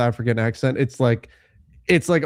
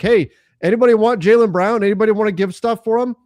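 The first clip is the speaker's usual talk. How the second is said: higher, louder mock offer to the room; mock calling out; 8 semitones higher, 5 dB louder than usual. Host pretends to shop a player around, calling out. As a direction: hawk it to a crowd of bidders.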